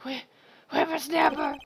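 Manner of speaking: put-on loud old-crone yell; crotchety old woman shouting; cranky old lady. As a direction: snap it like a furious granny. Old lady yells at boy.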